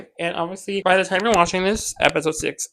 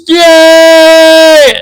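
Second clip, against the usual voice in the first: crowd cheering; 10 semitones higher, 14 dB louder than usual. Retold or imitated. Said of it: "everybody was like".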